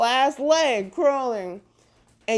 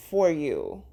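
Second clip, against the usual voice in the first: ordinary talk, nothing marked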